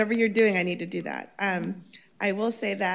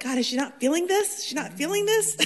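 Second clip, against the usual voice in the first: rising higher and higher in disbelief, from a high question to a squeak; incredulous inner voice; 10 semitones higher, 6 dB louder than usual. Retold or imitated. Councilwoman mimicking her own wonder at her calm colleague, climbing to a high disbelieving squeak. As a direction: ask it twice, the second time much higher and amazed. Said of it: "And I'm like".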